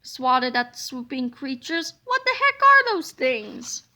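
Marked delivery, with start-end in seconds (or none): 2.0-3.8 high, loud alarmed question; higher, louder startled villain. A narrator's reading. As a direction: jump in shock at the bugs. Monster startled by creatures.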